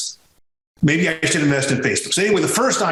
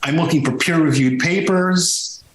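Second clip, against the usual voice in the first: usual speaking voice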